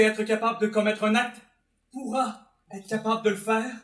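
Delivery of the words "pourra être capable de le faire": ordinary talk, nothing marked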